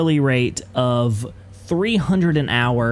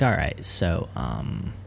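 lawyer reading will; 8 semitones lower, 10 dB quieter than usual. Pretend. Friend plays the lawyer deducting from the will, low and quiet. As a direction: drop low and quiet like a dry lawyer doing the math.

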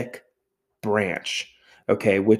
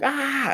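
alarmed cry. Retold or imitated. Family panics at once.

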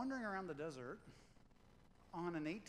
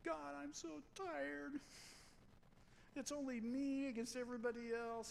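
weary Elijah complaining; 9 semitones higher than usual. Retold or imitated. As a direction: stretch every word out in a high, tired whine. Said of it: "and he goes".

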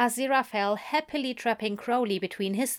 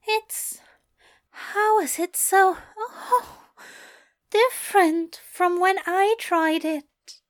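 higher, louder breathless wonder; overwhelmed Aziraphale; 9 semitones higher, 5 dB louder, slower than usual. A narrator's reading. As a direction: gasp it out, overwhelmed.

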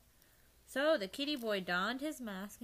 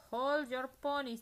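commanding; 6 semitones higher, 8 dB louder than usual. Acted. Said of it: "Don Diego spoke up".

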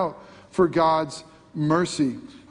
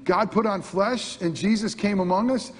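normal talking voice

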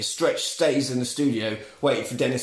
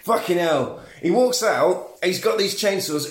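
ordinary delivery

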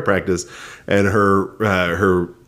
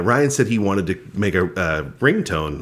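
ordinary talk, nothing marked

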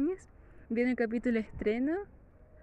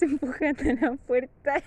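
ordinary delivery

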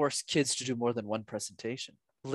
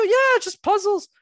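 put-on casual kid voice; 5 dB louder than usual. Voicing kids' casual reply.

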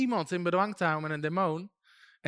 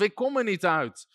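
usual speaking voice

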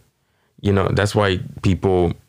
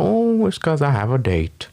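put-on higher, coy old-lady voice; giddy grandma; 8 semitones higher than usual. Grandma telling the grandkid about her date, coyly. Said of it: "She's like".

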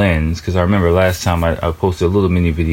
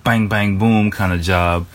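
usual speaking voice

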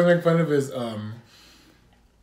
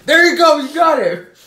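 high, louder excited cheer; cheerful praise; 11 semitones higher, 9 dB louder than usual. Retold or imitated. Retelling the parody, the coach cheers. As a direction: cheer him on brightly. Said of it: "celebrating the found voice".

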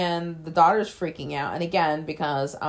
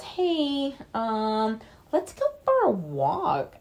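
mock friendly lure; 5 semitones higher, much slower than usual. [name] lures Alice outside.

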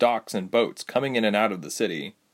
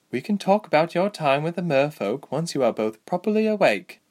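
higher-pitched, frank woman fighter; Lieselot; 7 semitones higher than usual. Acted. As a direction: answer lightly in a raised character voice. Voices Lieselot.

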